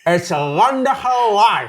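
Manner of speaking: slow, loud, laughing through a guard; wearing a mouthguard. Real, not acted. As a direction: force the words past a gum shield. Mouth guard game phrase.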